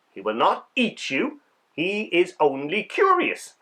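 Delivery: ordinary delivery, nothing marked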